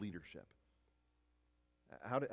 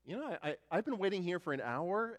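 indignant complaining; 9 semitones higher, 4 dB louder than usual. Retold or imitated. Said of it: "You, you look at it and go".

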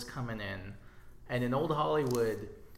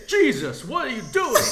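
loud, high-pitched mock outburst; Harvey Keitel impression; 12 semitones higher, 13 dB louder than usual. Imitating an actor bursting into a room, loud outburst. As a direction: burst in shouting, hammed up. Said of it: "Harvey Keitel bursting into a room saying".

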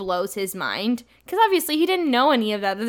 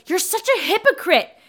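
angry retort; 7 semitones higher than usual. Retold or imitated. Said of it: "And he goes".

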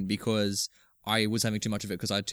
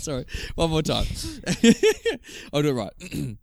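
ordinary talk, nothing marked

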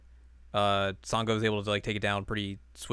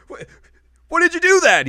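shocked yell; 15 dB louder than usual. Retold or imitated. Shocked retold quote.

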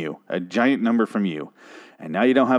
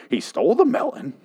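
swooping, theatrical movie-character voice; hammed-up oilman impression; Daniel Plainview. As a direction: declaim it like a grim film monologue. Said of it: "describe it like, uh, William".

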